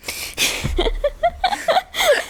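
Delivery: breathless high wheezing laugh; wheezy laugh. Real, not acted. Laugh after punchline.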